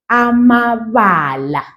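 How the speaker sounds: amabala said slowly, each syllable clear; drawn out amabala; slow demo. Shown as a demonstration. Teacher modelling plural for learners, slowly. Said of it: "That's how you say a word".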